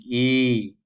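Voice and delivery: I loud, lips stretched sideways; spread vowel. Shown as a demonstration. Teacher showing how I must be pronounced, fully articulated. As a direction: widen the mouth on a long I. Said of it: "melebar ke samping".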